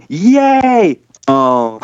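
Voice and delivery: high crowd cheer sagging into "oh"; cheer turning to letdown. Retold or imitated. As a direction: imitate an audience cheering, then deflating. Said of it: "as the noise is".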